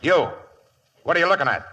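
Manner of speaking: startled angry demand; sharp challenge. Acted. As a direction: catch her snooping and snap. Catches woman snooping.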